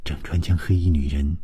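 ordinary delivery

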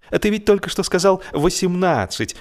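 high, startled objection; surprised George. Acted. George catching Harris in a lie, surprised. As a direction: catch him out, amazed. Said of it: "удивился Джордж".

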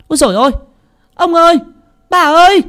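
high, loud frantic shriek; a maid screaming for her masters; panicked outcry. Acted. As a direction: cry out in sudden horror. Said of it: "la lên thất thanh".

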